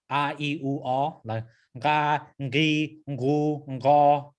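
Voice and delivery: nga, ngi, ngu, ngo, slow and separate; nga with vowels. Shown as a demonstration. Teacher shows nga with each vowel in turn. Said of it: "if you put the vowels".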